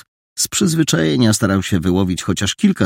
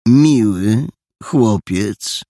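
gentleman agreeing; 3 semitones higher, 3 dB louder, much slower than usual. Acted. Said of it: "potwierdził jegomość".

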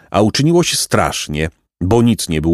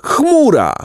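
very high shrieked warning; alarmed shout. A narrator's reading. Jacek warning the pelican of the cloud, high shriek. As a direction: shriek as the cloud rushes at you. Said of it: "krzyknął Jacek".

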